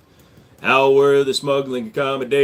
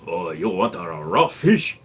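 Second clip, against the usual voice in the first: mock Japanese accent; 9 semitones lower, slower than usual. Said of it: "[name] does an excellent Japanese".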